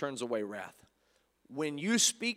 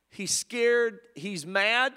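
usual speaking voice

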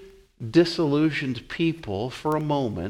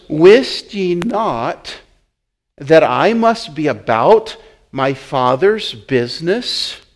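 slow solemn reading; 5 dB louder, slower than usual. Acted. Jesus' reply read slowly.

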